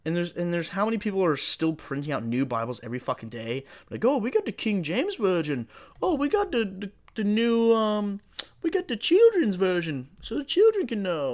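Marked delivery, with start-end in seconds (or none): 4.0-11.3 higher, louder mock sales pitch; mock Bible promoter. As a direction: lightly imitate eager Bible printers. Mimicking people printing new Bibles.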